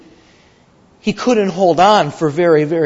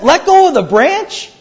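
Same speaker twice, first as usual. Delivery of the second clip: much higher, louder disbelieving echo; can't believe what he just heard; incredulous; 10 semitones higher, 5 dB louder than usual. Acted. Man hears Lord's answer.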